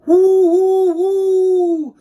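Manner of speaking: high loud train whistle hoot. A narrator's reading. Reader imitating the train's whistle, high and loud. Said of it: "The train whistled".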